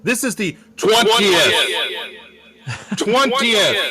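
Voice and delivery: high, loud hype-man announcement; over-the-top enthusiasm. Acted. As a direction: blast the episode number like an arena announcer. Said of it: "where's the enthusiasm, man?".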